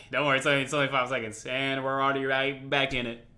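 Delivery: normal talking voice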